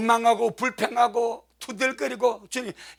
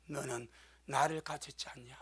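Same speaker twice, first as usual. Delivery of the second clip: hushed, gentle question; soft voice of the Lord; 8 semitones lower, 14 dB quieter than usual. Retold or imitated. Pastor voicing the Lord to a failing minister, soft and hushed. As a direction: ask it softly, like a tender rebuke. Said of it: "주님 딱 말씀하더래".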